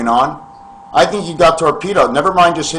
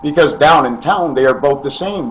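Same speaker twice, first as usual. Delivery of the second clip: ordinary talk, nothing marked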